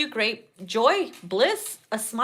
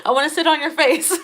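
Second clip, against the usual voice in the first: higher, louder playful blurt; playfully blurted; cheeky exclaim; 5 semitones higher, 5 dB louder than usual. Retold or imitated. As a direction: say it loud and cheeky through a laugh. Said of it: "Hold on".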